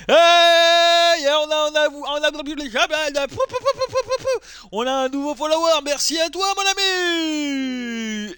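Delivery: high, drawn-out hype announcement; excited shout-out. Real, not acted. Streamer thanking a new follower, high and excited. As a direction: celebrate at a high pitch, stretching the last words.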